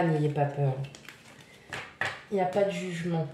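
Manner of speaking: usual speaking voice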